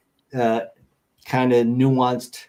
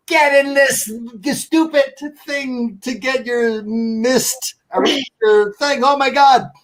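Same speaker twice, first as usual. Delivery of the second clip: high, loud hammed-up yell at the ghost; mock yelling; 11 semitones higher, 3 dB louder than usual. Hosts yelling at a ghost to enter the machine, mock yell. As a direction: scream commands down the hallway. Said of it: "they're yelling at whatever ghost".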